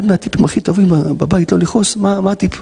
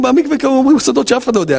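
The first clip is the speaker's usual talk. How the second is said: ordinary talk, nothing marked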